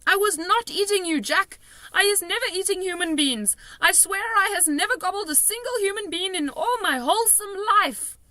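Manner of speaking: high, loud frantic protest; desperate denial. A narrator's reading. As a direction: swear innocence in panic. Giant lying desperately.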